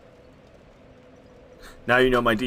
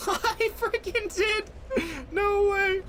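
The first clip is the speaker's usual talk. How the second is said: very high, stunned squeal of joy; real delighted screech; ecstatic; slower than usual. Real, not acted. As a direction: scream it in sheer disbelief. Rock bolt hit lands.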